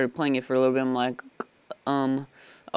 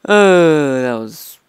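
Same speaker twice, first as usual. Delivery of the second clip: ordinary talk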